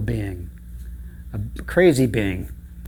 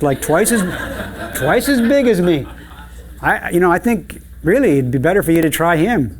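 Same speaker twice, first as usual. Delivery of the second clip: higher-pitched, louder small-goat voice; pleading little goat; 5 semitones higher, 3 dB louder than usual. Acted. Little billy goat persuading troll to wait, high pleading. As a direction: talk your way out, high and eager.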